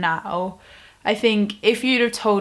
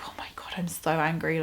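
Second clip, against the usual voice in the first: soft, fast fuming whisper; whispered annoyance; whispered fume; 4 semitones lower, 8 dB quieter, faster than usual. Retold or imitated. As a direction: hiss it under your breath. Muttering anger while seeing butterflies.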